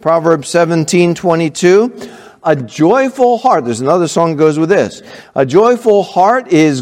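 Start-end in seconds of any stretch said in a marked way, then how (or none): none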